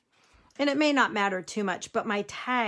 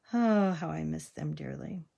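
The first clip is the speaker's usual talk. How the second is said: ordinary delivery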